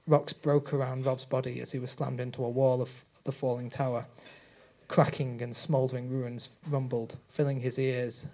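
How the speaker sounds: ordinary delivery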